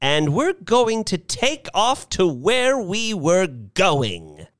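put-on high, resolute elderly voice; determined old lady. A narrator's reading. Missus Bellows ignoring the crying man, determined. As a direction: announce it high, brooking no argument.

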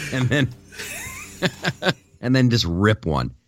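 words breaking into laughter; giggling while getting the joke out; laughing. Real, not acted. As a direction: laugh through the punchline.

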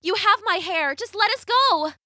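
high heroine voice; high, desperate storybook girl; pleading Rapunzel. A narrator's reading. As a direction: plead urgently for release.

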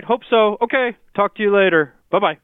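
ordinary talk, nothing marked

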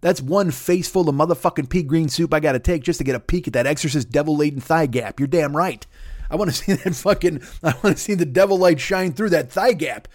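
ordinary talk

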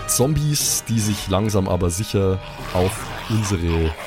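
ordinary talk

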